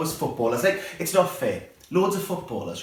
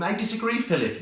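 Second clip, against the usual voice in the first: mock Queen; 7 semitones higher than usual. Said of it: "the Queen going".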